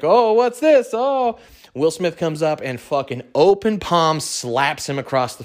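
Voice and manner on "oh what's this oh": high, bright mock surprise; imitating Chris Rock taken aback, high and louder; mock surprised comedian. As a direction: play the amused host caught off guard. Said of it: "he's like".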